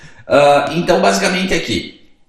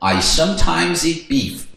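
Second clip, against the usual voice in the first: slowed classroom English; slow model answer; slower than usual. Shown as a demonstration. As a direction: say it slowly and clearly. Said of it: "como carne vermelha". (resposta lenta e clara)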